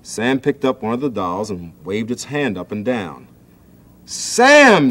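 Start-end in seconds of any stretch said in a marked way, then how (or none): 4.0-4.9 much higher, loud scream of the name; Marcia screaming. A narrator's reading. Sister Marcia screams at Sam over her doll. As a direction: shriek at him to stop. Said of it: "screamed Marcia".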